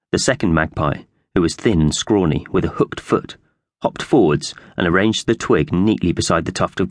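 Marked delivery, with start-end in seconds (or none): none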